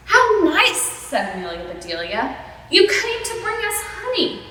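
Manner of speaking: high, cheerful and bright; high sweet voice. Acted. Amelia cheerful misunderstanding.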